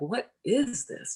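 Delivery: high, slow puzzled question. Retold or imitated. Feeling a strange lump, slow high puzzled wonder. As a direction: wonder aloud, slowly and high.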